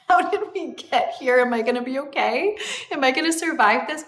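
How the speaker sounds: high, half-laughing anxious questions; retold freak-out with a laugh in it; mock panic. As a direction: play up a light panic, pitch jumping up.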